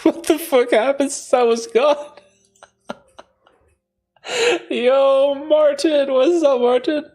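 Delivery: real giddy laughter calling out to a friend; laughing. Real, not acted. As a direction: squeal the words through laughter. Laughing greeting to returning viewer.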